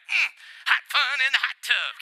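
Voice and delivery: playful mock [name] hum into the line. Preacher joking to congregation as [name] in boiling oil, light impression. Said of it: "Like [name]".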